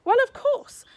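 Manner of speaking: high, loud horrified cry. A narrator's reading. Lila horrified at talk of marriage, loud and high. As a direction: blurt it out in shock. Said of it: "Lila was horrified".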